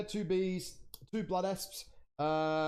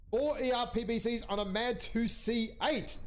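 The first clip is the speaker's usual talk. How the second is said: ordinary talk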